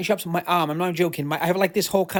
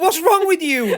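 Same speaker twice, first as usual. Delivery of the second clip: high, loud playful scolding; mock outrage; 9 semitones higher, 5 dB louder, slower than usual. Rebuking co-host over the joke name, loud mock outrage. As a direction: scold loudly in pretend disbelief.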